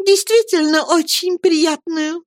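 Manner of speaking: high, slow, pleased mother; mother's voice. A narrator's reading. Mother agrees with Peggotty, slow and high. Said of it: "подтвердила матушка".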